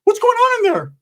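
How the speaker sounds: very high, squeaky alarm; excitable Ricky impression. Ricky checking on Mom through the door, very high squeaky voice. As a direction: call out in cartoonish worry. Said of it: "Ricky's like".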